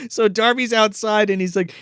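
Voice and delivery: normal talking voice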